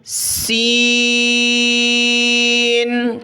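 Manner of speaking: "سین" with hissed s and very long vowel; whistling s. Shown as a demonstration. Teacher demonstrates whistle letter, "سین" hissed and stretched. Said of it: "سیٹی کی آواز نکالی جاتی ہے".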